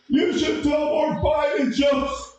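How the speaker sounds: put-on high, slow, strained old man's voice; old man heckler. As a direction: squeak it out like a cranky old man on a cruise ship. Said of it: "some old man goes".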